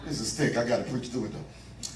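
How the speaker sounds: low, soft muttered aside; quiet aside. Real, not acted. Preacher to himself mid-sermon, quiet aside. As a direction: drop right down, as if to yourself.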